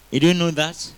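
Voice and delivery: usual speaking voice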